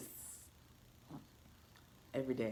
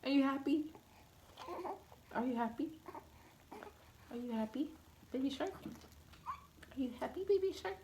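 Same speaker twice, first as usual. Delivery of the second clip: high squeaky voicing of the baby; mommy voicing her infant, high and squeaky; baby's voice; 13 semitones higher than usual. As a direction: pretend to be the baby asking, way up high. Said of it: "She's like".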